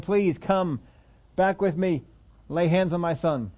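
high, pleading request for help; the nobleman begging Jesus to come heal his son, pleading; pleading father. Retold or imitated. A hint of a desperate father. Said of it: "implored him".

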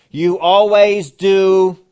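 loud, slow, punching "always"; mock exasperated complaint; nagging accusation. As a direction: throw it like a fed-up family member. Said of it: "have you heard them say this".

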